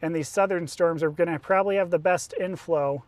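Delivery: ordinary talk, nothing marked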